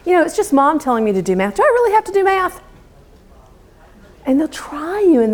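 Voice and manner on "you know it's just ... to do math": put-on quick rising whine of a child dodging math; lightly voiced child testing mom; whiny child. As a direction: mimic a kid pushing back, up high and quick. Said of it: "children will challenge you".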